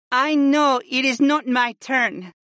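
high female character voice; needy wife. A narrator's reading. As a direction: keep pleading in the wife's high voice. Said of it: "Freya continued".

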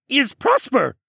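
high, loud, excitable creature voice; Jar Jar Binks and Doug from Up blend. A narrator's reading. Creature's voice popping into Alex's head, goofy and high. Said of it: "A voice that sounded like a combination of Jar Jar Binks and Doug from Up".

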